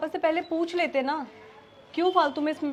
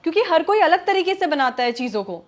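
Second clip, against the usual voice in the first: heated, raised voice; angry; 4 dB louder than usual. Acted. Wife scolds husband for comparing hands' taste, angry. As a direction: argue back forcefully. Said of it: "तुम इस बात पे इतना गुस्सा".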